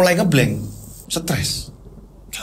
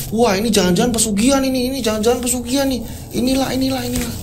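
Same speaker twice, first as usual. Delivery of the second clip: high, excited gossiping crowd; alarmed villagers; 7 semitones higher, faster than usual. Acted. Villagers gossiping about the fire, alarmed. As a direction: voice neighbours jumping to suspicion. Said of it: "warga banyak yang ngomong".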